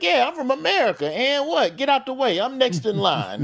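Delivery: high-pitched cocky mock boast; hammed-up brag; bragging. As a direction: act the pushy American abroad, swaggering. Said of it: "You used to be able to brag".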